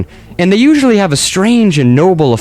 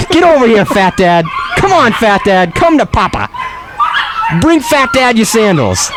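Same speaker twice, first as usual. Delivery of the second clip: coaxing a dog; 8 semitones higher than usual. Pretend. Host coaxes corgi Fat Dad to come to Papa, high doggy-coaxing voice. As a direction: coax the dog over in a raised pitch. Calls the Welsh Corgis over.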